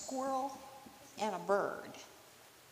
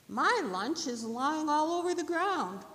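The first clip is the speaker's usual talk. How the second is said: high squirrel voice, climbing louder; delighted squirrel; 8 semitones higher, 7 dB louder than usual. Acted. Squirrel spotting lunch on the ground, delighted. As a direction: exclaim higher at the end.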